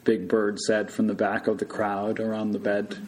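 ordinary delivery, nothing marked

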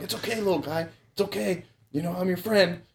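high, soft cooing to a scared owl; soothing an animal. Retold or imitated. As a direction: coo it gently like calming a frightened pet. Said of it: "I'm all talking to him like".

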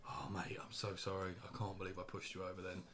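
low, very soft apology; acted remorse. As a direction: sheepishly shrink back after going too far. Acted apology after pushing customer over.

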